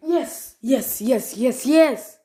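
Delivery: loud, high, repeated cheering; real victory cheer; triumphant yes. Real, not acted. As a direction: celebrate the win out loud. Celebrating a win.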